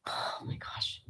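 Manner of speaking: barely-there whisper; hushed shock. Pretend. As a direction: whisper it as if stunned.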